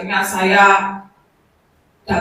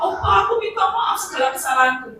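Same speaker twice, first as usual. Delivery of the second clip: pleading apology; 9 semitones higher, faster than usual. Retold or imitated. Grandma begging her dying husband's forgiveness, higher and fast. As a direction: beg forgiveness, high and quick.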